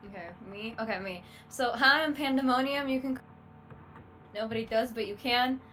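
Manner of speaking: ordinary talk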